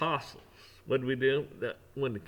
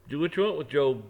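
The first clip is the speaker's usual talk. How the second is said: God's permission; 3 semitones higher, 8 dB louder than usual. Retold or imitated. God permitting devil.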